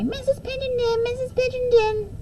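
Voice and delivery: put-on high, sing-song kids calling teacher; second graders calling for their teacher; eager children calling. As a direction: mimic the whole class pleading for attention.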